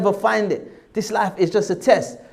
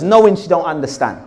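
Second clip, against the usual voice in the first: usual speaking voice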